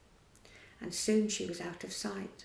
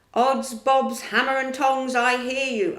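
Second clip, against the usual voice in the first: loud put-on pirate captain voice; Peter imitating Hook; 3 semitones higher, 9 dB louder than usual. A narrator's reading. Peter answers Hook, loudly imitating his voice. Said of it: "answered Peter in Hook's voice".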